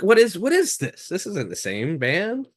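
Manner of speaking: high-pitched retold bafflement; baffled disbelief. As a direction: imitate your puzzled younger self, pitch jumping up. Retold baffled reaction to a record.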